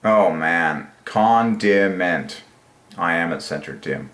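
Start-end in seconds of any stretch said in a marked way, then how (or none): 1.0-2.4 slow. Real, not acted. Savouring the word.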